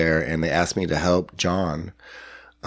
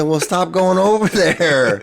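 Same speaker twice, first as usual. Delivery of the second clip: laughing; 12 semitones higher, 5 dB louder than usual. Retold or imitated.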